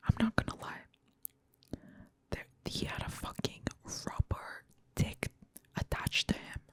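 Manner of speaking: hushed, unvoiced whisper; confiding whisper. Host confides to viewers about sex scenes, in a whisper. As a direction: whisper it like a guilty secret to your listeners.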